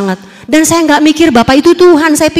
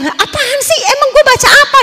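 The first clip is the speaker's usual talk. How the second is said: high, irritated snap back; annoyed retort to a nagging voice; 10 semitones higher than usual. Retold or imitated. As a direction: brush off the voice, fed up. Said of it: "saya udah mulai kesel".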